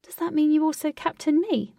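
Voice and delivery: teasing question, pitched high; playful testing. A narrator's reading. Girl playfully testing the blushing captain, teasing. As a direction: tease with a lifted voice. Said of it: "she was playfully testing him".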